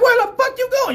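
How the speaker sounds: very high, loud incredulous shout; retold high-pitched holler; yelled challenge. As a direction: imitate an officer yelling after someone. Cop yells at man walking away.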